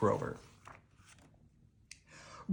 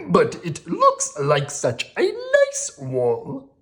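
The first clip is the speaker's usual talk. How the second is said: higher, slow puzzled little monster; Grover voice; 5 semitones higher, 6 dB louder, slower than usual. Acted.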